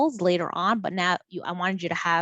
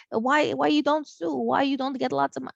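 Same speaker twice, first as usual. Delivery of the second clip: put-on Arabic accent; 6 semitones higher than usual. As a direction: voice your mom with a thick Arabic accent. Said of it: "this is with the Arabic accent".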